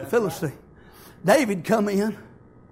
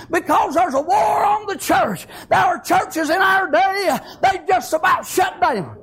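preaching at full force; 10 semitones higher, 4 dB louder than usual. Acted. Preacher rallying the church against a war on Christianity, shouted preaching. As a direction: drive every line home to the pews.

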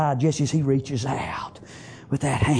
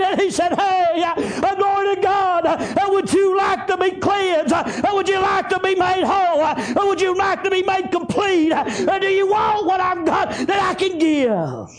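shouted, rhythmic revival offer; full-force preaching; 14 semitones higher than usual. Acted. Preacher voicing Jesus offering cleansing, full-force preaching. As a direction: press each question on them in driving bursts.

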